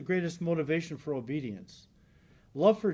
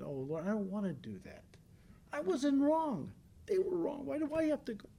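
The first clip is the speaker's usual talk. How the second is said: higher, petulant complaint; whiny protest; 9 semitones higher, 5 dB quieter than usual. Pretend. Believer complaining to the Lord about making amends, higher whiny voice. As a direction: whine like a sulking child who won't apologize.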